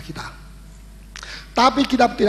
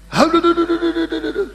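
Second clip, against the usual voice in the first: mock dismay; 8 semitones higher, 4 dB louder than usual. Pastor groans at hard teaching.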